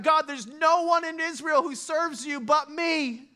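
mock self-pitying complaint; Elijah crying out. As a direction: wail it out like a lonely prophet. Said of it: "he's crying out to God".

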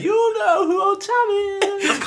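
extremely high, drawn-out shriek; eerie mocking local. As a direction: squeal it slowly like a creepy local. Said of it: "That's what it was like".

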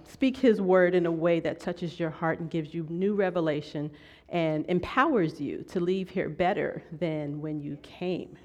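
ordinary delivery

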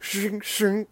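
high metallic shink sound effect; blade slash. Pretend. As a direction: sing out a sword swish. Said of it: "your go-to noise".

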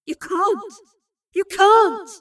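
high cry building to a loud shout; desperate protest. A narrator's reading. Voices of bitter argument in her vision, desperate protest.

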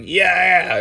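loud, high whooping yell; re-enacted shout of approval; imitated crowd cheer. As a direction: holler along like rowdy neighbors. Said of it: "A couple of them were like".

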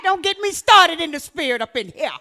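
normal talking voice

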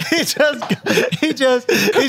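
giggly false starts, settling as it goes; laughing while talking. Real, not acted. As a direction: fight through laughter to finish. Breaks up telling the joke.

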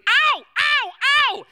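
put-on high, loud repeated child's yelps; kid's yelps. Another stung kid, high loud yelps. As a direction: yelp over and over like a stung kid.

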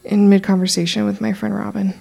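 ordinary delivery, nothing marked

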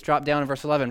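usual speaking voice

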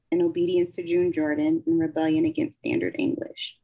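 ordinary talk

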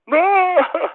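very high mock wail; imitated bawling. Husband imitating his wife at the bad news, mock bawling. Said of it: "she just started bawling".

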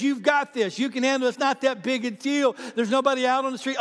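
put-on the voice that talks a drunk man into driving; tempting minimizing voice. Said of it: "minimizing voice that says".

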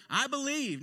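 loud, high exclamation; calling out. Acted. Peter calling across the water to Jesus, loud call.

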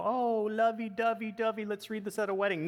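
high, gushing mock-sentimental voice; syrupy singsong parody of romance; sloppy romantic. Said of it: "some kind of sloppy".

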